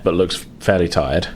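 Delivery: usual speaking voice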